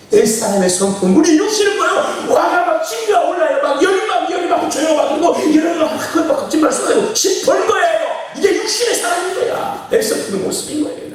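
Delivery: high, loud sustained sermon shout; impassioned pulpit cry; fired-up preaching. Real, not acted. Preaches on anger at full force.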